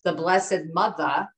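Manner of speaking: slow "the Blessed Mother", Mother ending in THA; Jersey pronunciation. Shown as a demonstration. Daughter quotes mom's warning, "Mother" slow and without R. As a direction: draw out "Mother" as Jersey MUTHA. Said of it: "M O T H A".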